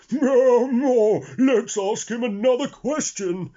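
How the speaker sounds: loud, high sniggering elephant. A narrator's reading. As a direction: snigger with glee. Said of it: "sniggered the clever elephant".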